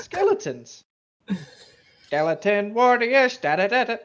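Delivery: loud, high dramatic announcement; dramatic announcer. Pretend. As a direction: hype it like a movie trailer.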